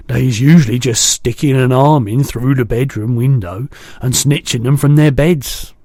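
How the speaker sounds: a big kindly giant chatting; booming gentle giant; the BFG. A narrator's reading. BFG voiced.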